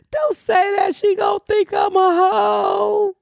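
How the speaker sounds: high, slow, embarrassed plea; flustered singer. Retold or imitated. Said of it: "she tell Quisha".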